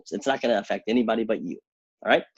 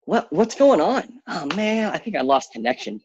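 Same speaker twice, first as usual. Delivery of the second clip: fake lost connection; 9 semitones higher, 3 dB louder than usual. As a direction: play dumb as if the stream dropped.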